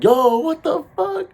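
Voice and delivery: drawn-out, high, loud disbelief; stunned what the. Retold or imitated. Homeboy seeing the kiss, slow high shocked yell.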